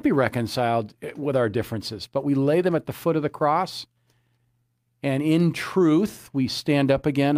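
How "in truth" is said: "in truth" stretched slow, higher and louder; emphasis on "in truth". Real, not acted. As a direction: lean hard and slowly on "in truth". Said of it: "I emphasize that in truth".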